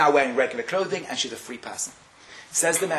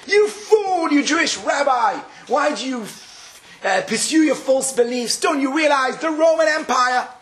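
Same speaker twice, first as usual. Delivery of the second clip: high, loud sneering official; mocking Roman minister; 8 semitones higher, 4 dB louder than usual. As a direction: scorn the condemned rabbi from above. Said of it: "the Roman minister is giving him a drasha".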